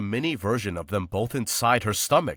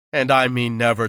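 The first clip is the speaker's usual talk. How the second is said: never pushed higher and louder; emphatic warning; 5 semitones higher, 9 dB louder than usual. Acted. Jason warning Damon off Sarah's Nutella, louder and emphatic. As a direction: hammer home the never.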